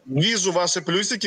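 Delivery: normal talking voice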